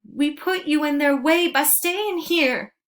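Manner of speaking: high, loud cry of grief; anguished cry. Acted. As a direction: wail it over her body. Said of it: "Pink cried".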